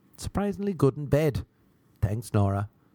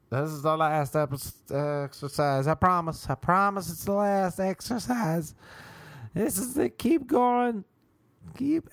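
mock yoga teacher; 6 semitones higher than usual. Sub teacher lying about last exercise.

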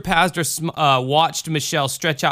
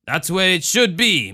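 put-on lilting Cajun accent, a bit higher; Ma Marie; 5 semitones higher than usual. A narrator's reading. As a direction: Cajun mother explaining. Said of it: "Ma Marie told her".

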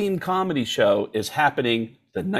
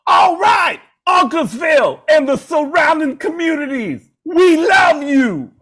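[name] stage shout; 7 dB louder than usual. Retold or imitated. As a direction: roar it to the whole arena. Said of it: "at the very end of the show".

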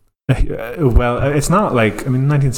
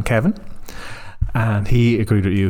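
ordinary delivery

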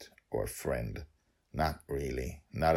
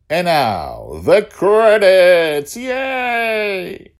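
much higher, much louder, slow hammed-up cheer; excited credits fanfare; 19 dB louder, much slower than usual. Host announcing credits to listeners, hammed-up cheer. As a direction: shout it like a game-show host.

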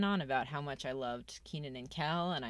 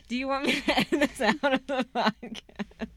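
laughing; 8 semitones higher, 5 dB louder than usual. Real, not acted. Laughing after the accent.

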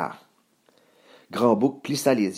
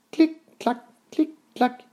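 high little clip-clop sounds; light, high clicking of hooves; hoof clicks. A narrator's reading. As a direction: imitate tiny hooves in a high voice. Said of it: "firent ses sabots".

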